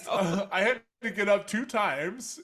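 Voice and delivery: laughing. Real, not acted. Telling a funny story, breaking up.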